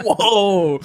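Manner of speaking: high, drawn-out mock whoa; amazed crowd. The crowd is won over, high mock-amazed whoa.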